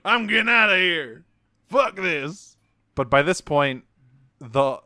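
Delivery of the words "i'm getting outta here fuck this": louder, higher cowboy twang; hammy startled cowboy accent. Retold or imitated. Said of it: "they were like".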